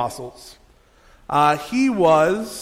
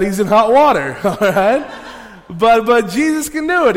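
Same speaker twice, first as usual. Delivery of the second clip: chuckling through it, louder; laughing; 5 semitones higher, 7 dB louder than usual. Real, not acted. Pastor joking to the congregation about rebukes, laughing. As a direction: laugh while landing the joke.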